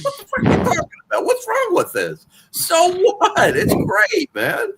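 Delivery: mocking fans ashamed of wrestling, indignant; incredulous outburst. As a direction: act baffled that anyone would hide it. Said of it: "I'm like".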